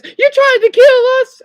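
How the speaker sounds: very high, loud squeaky fish character; cartoon fish voice. SpongeBob fish accusing Patrick, in a very high squeaky voice. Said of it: "and then the fish says".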